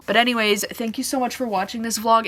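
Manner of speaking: usual speaking voice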